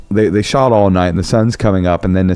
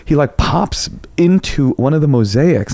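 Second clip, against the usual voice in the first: ordinary talk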